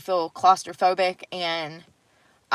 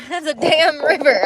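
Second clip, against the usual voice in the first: laughing; 6 semitones higher, 6 dB louder than usual. Real, not acted. Laughing, high and loud.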